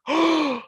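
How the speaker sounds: sharp, high intake of breath; shocked gasp. Retold or imitated. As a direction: gasp like a horrified onlooker. Imitating shocked gasp from booth.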